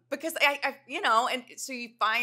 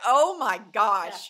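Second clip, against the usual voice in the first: slow, louder mock dismay; drawn-out oh my gosh; 9 dB louder, much slower than usual. Friends hearing it was just one glass, slow mock dismay. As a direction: stretch it out in mock horror.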